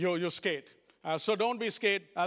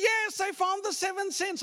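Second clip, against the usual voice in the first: mock excited; 8 semitones higher than usual. Excited accountants imitated.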